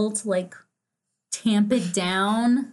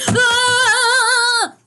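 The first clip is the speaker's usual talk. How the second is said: high loud mock blast cry; grenade fuse and boom; 12 semitones higher, 7 dB louder than usual. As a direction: shout a fake explosion. Mock explosion shout at wire cut.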